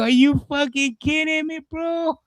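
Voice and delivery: high-pitched, amused disbelief; mock the beggar in a squeaky, tickled voice; retold incredulous laugh. Retells laughing in the man's face.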